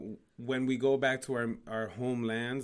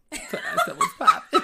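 much higher, laughing through the words; squealing with laughter, louder; laughing joke; 7 dB louder than usual. Pretend. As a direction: crack up while delivering the punchline. Joking last words, laughing.

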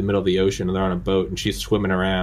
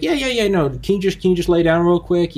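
high, louder breezy reassurance; mock calm doctor; 11 semitones higher, 5 dB louder than usual. Cardiologist hurrying patient onto table, high breezy mock voice. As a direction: brush it off cheerfully.

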